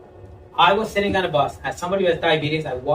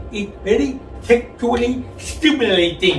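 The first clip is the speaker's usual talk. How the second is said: garbled tongue-stud speech; 5 semitones higher than usual. Retold or imitated. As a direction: talk like you just learned to talk. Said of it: "sounded like somebody who just learned how to talk".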